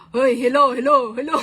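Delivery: loud mic-check hello; calling out. Real, not acted. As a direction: call out to be heard. Checking if friend hears her. (malakas na pagtawag)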